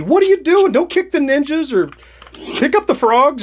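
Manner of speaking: high-pitched, exasperated game fan yelling; mock screaming fan. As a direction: holler it like an outraged backseat player, hammed up. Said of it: "someone who loves this game is screaming at me".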